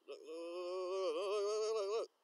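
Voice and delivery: stoned streamer insisting viewers watch the fly, excited; excited urging. Real, not acted. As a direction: demand they look right now.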